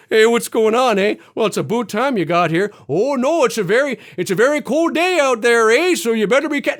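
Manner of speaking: exaggerated Canadian accent, bouncy and lifted; cartoon Canadian accent. Mocking Canadian characters in cartoons, hammed-up Canadian accent. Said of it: "whenever there's a Canadian character, it's always".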